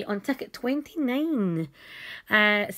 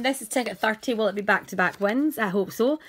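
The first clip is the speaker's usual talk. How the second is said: normal talking voice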